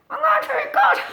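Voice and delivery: high, loud squeaky little squirrel shouting a promise; squirrel puppet voice. Pretend. Puppet squirrel promises the boys and girls, high loud squeaky shout. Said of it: "Tell the boys and girls you're going to be good".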